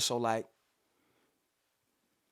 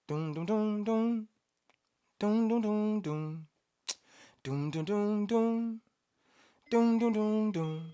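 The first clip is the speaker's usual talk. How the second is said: beatbox melody; 10 semitones higher, 4 dB louder, much slower than usual. Shown as a demonstration. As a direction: sing doom doom doom high and louder. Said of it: "it goes".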